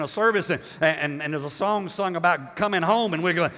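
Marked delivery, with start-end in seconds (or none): none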